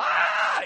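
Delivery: breathy startled "ah" of a cut-off driver; startled yelp. Retold or imitated. Cut-off driver yelping at the sudden swerve, startled breathy cry. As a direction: gasp like someone just got cut off.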